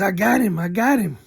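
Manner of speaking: a woman crowing over a catch; triumphant wife. Retold or imitated.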